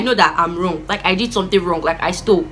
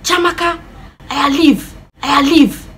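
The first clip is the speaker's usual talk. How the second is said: higher, punchy imitated order; commanding father; 5 semitones higher, slower than usual. Dad intervening, imitated in a raised firm call.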